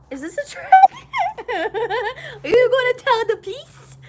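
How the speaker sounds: high, loud, breaking into laughter; mock suspicion. Patient asking doctor about weed question, laughing mock alarm. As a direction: imitate a panicky question through giggles.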